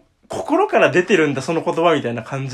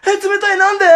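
startled squeal, pitched way up and louder; surprised high yelp; 8 dB louder than usual. Retold or imitated. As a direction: mimic a friend suddenly shocked by cold air. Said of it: "そしたら河野くんが". (冷たい風に驚いた友達を真似て、甲高く叫ぶ)

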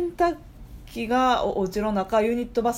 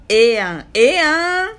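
louder, rising "ええやん" repeated, the second much higher; gushing; 5 semitones higher, 8 dB louder, slower than usual. Acted. Reading the listener's gushing message aloud, excited and loud. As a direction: gush over it like a delighted Kansai fan.

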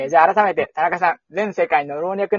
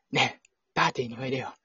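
soft, low [name] invite; [name] impression; 6 semitones lower, 9 dB quieter than usual. Shown as a demonstration. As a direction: murmur the invite like a heartthrob. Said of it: "すごいキムタクだったわ".

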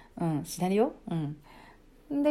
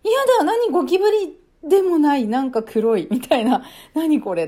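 panicked; 6 semitones higher, 7 dB louder than usual. Retold or imitated.

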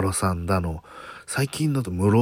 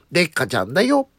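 キャラ声のまね; 7 semitones higher, faster than usual. Retold or imitated. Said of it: "なんか似てるってなって".

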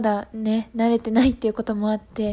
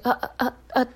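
nervous stammer. Retold or imitated. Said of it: "実際話し始めてからも緊張して".

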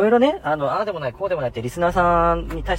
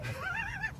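burst of laughter; 11 dB quieter than usual. Real, not acted. Co-host cracks up at persona.

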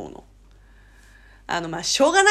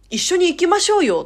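higher, louder coaxing invitation; strong emphatic nudge; 8 semitones higher, 5 dB louder than usual. Shown as a demonstration. Inviting a friend along, insistently coaxing. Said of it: "念押しのニュアンス強めじゃないですか".

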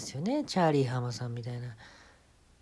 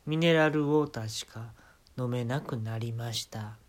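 usual speaking voice

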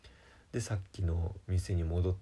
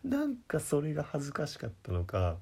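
normal talking voice

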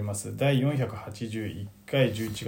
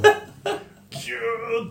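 slow, higher stretched ギューって; squeezing sound; 11 semitones higher, much slower than usual. Acted. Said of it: "ギューって". (ギューっと引き伸ばして)

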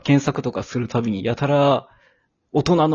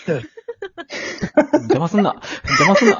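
笑いながらツッコミ; 9 semitones higher, much slower than usual. Real, not acted. 相方の茶々を笑って止める.

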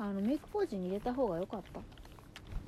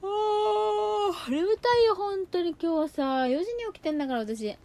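loud, high drowsy complaint; sleepy whine; 11 semitones higher, 10 dB louder, slower than usual. Real, not acted. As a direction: whine about being so sleepy. Tired outburst mid makeup review.

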